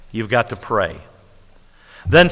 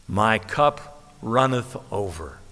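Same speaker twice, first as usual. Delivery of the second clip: savoring calm; 4 semitones lower, 6 dB quieter, slower than usual. Acted. Savoring a scripture phrase.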